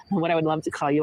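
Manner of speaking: ordinary talk, nothing marked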